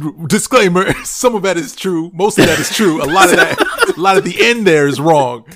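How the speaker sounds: loud, laughing correction. Real, not acted. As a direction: laugh through the disclaimer. Laughing disclaimer, louder and higher.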